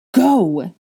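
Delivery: loud yell; loud command. A narrator's reading. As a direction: yell the order. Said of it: "he yelled".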